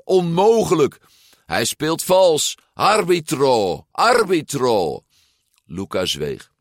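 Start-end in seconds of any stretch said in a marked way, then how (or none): 0.0-5.0 high, loud, drawn-out protest; shrieking. A narrator's reading. Overtaken rider calls the referee, shrieking loud and high. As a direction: yell indignantly for the referee. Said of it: "gilde Ercole".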